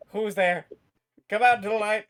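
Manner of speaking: mock villain shouting at the shadows; calling out. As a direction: challenge a hidden gunman, loud. Jester calls to unseen shooter.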